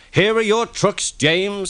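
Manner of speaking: high, teasing tank engine voice; cheeky Thomas. Acted. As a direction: tease him like a cheeky little engine.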